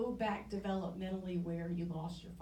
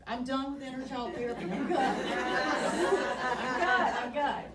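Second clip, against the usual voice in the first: louder, higher, laughing I'm good; laughing brush-off; 4 semitones higher, 7 dB louder, slower than usual. Retold or imitated. To her inner child therapist, a loud laughing refusal. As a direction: laugh it off, waving the therapist away. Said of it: "And I'm like".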